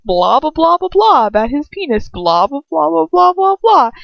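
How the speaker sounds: loud, high blah-blah taunt; mock gloating babble. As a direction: rattle it off to rub it in. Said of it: "you're just like".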